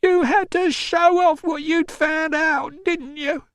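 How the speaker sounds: high, slow, bitter wail; accusing wail. A narrator's reading. Mother blaming visitor for meddling, wailing. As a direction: wail the reproach at him. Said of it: "she wailed".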